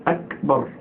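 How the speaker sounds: أكبر split as "ak... bar"; clear kaf. Shown as a demonstration. Teacher giving the corrected word, careful kaf. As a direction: stop on a crisp k in أكبر, then bar. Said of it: "مش أج".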